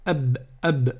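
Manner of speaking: "ab" with a short clean a and a stopped b; Arabic ab. Shown as a demonstration. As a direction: say "ab" as one clipped syllable. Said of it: "on va dire ab".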